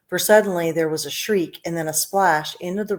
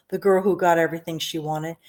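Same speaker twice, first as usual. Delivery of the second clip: plain reading voice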